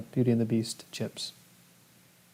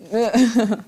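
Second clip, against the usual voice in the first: pitched up, louder teasing put-on; playful mock accent; 10 semitones higher, 5 dB louder than usual. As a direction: lightly tease him in a fake accent. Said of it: "an accent just to say that I'm playing around".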